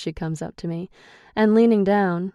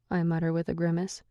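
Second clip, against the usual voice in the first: ordinary delivery, nothing marked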